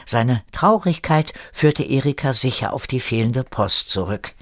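usual speaking voice

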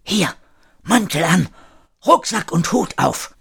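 strict, urgent orders, pitched high; strenges Flüstern. A narrator's reading. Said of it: "wurde daraufhin streng und flüsterte". (streng, eindringlich)

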